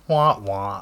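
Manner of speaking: low, comic "wah, wah" letdown; mock sad trombone. Mock letdown after hearing none.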